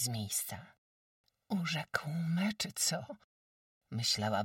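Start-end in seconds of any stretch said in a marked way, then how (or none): 1.5-3.1 put-on dazed girl's voice. Jagusia thinking, slow.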